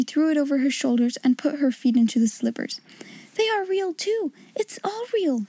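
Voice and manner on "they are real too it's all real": cried out. Acted. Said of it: "she cried".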